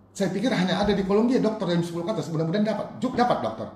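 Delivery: high, loud testimony; fervent preaching. Real, not acted. Preacher recounting the search for a doctor, fervent and loud.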